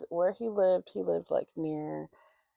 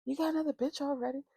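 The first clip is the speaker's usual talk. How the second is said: high, fast mock confrontation; rapid high-pitched accusation, acted out; imitating angry ex; 7 semitones higher, faster than usual. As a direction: mimic an outraged girlfriend, pitched way up. Voices angry ex confronting him.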